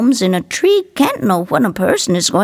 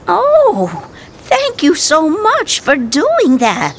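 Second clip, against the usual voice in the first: grateful, delighted; 9 semitones higher, slower than usual. Acted. Grateful surprised Marie.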